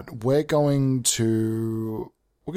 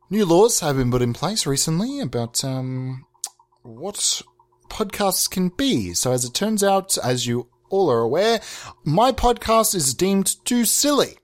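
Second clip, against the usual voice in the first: ordinary delivery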